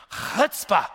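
guttural Hebrew "Chutzpah"; Hebrew accent. Shown as a demonstration. Preacher showing the congregation the word, attempted Hebrew accent. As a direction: scrape the Hebrew "ch" at the start of Chutzpah. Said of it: "I can't even do the accent".

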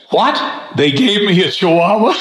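high, incredulous punchline delivery; comic disbelief rising into a question; mock astonishment. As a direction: act stunned by the news, pitch jumping up. Punchline, blind-man faker feigns shock.

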